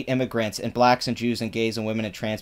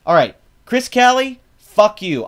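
louder, higher shout straight at him; loud callout; 6 semitones higher, 7 dB louder than usual. Real, not acted. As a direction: yell his name and curse him. Calls out the writer directly.

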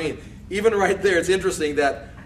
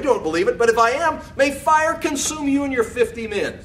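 raised, forceful declaration of judgement; emphatic scripture reading; 9 semitones higher, 4 dB louder than usual. Acted. Elijah defying the captain and his fifty men, emphatic reading. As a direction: proclaim it high and strong, like a prophet. Reads out Elijah's defiant answer.

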